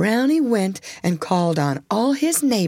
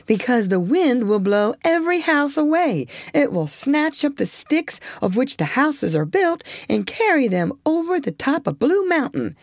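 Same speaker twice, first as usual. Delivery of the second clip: lightly voiced sly beaver, higher; a hint of a teasing rascal; lazy beaver voice; 7 semitones higher than usual. Tired Tim voiced in story.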